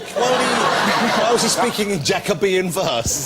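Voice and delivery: slow, high laughing question. Real, not acted. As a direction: laugh through the words. Host laughing at the story.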